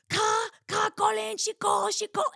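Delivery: mock frantic mother calling her husband; flustered mother calling Carl from the other phone; imitating frantic mom. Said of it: "My mom would be on the other phone".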